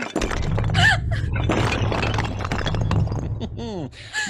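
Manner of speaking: high wicked cackling laugh; evil cackle. Acted. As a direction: cackle with glee at their doom. Old man gloats over trapped victims.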